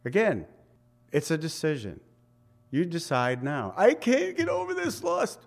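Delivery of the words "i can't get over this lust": high mock whine of an excuse-maker; mock the complainer, pitched way up; a high, pleading excuse; whiny excuse voice.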